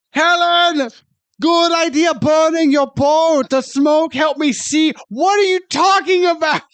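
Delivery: high-pitched comic outrage; exasperated rant. Acted. Host ranting at Gregor's nonsense line, high exasperated tirade.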